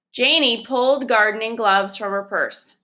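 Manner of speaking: plain reading voice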